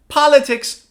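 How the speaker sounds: "Politics" with POL high and punched; "Politics" falling from a strong first syllable; stress on first syllable. Shown as a demonstration. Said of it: "read the three of these words".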